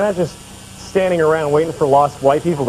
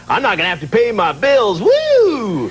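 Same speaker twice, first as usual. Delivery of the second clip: whooping, climbing higher, drawn out; mock celebrating; 5 semitones higher, 4 dB louder, slower than usual. People expecting Y two K to wipe bills, mock whooping glee. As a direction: whoop it up like a gleeful freeloader. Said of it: "some people are like".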